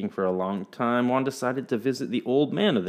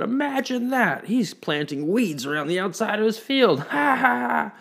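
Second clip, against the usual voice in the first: much higher, jeering laugh at the fool; mocking farmers; 12 semitones higher than usual. A narrator's reading. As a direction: sneer and cackle at Juan's weeds. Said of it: "they laughed".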